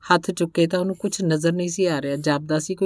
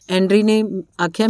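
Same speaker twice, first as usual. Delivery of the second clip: plain reading voice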